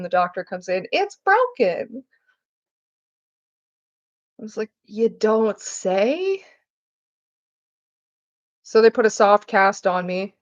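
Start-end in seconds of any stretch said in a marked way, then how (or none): 0.9-2.0 very high, drawn-out mock verdict; slow, high-pitched comic reveal; mock announcement. Doctor's reversal, acted out. 4.8-6.4 very slow sarcastic question, rising at the end; drawn-out, exaggerated sarcasm; mock surprise. Sarcastic reply to doctor.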